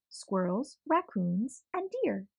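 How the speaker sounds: usual speaking voice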